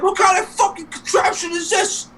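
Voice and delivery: very high-pitched mock nagging; complaining wife. Retelling Bowser's wife complaining, high nagging voice. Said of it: "you gotta see his wife be bitching about".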